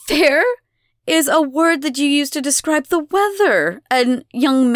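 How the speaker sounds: high, loud, lofty correction; scornful lecturing. A narrator's reading. Elena corrects Abigail's protest, scornfully. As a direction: put the child down grandly.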